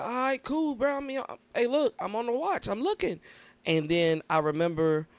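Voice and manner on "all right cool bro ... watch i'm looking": playful retold reply. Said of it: "I was like".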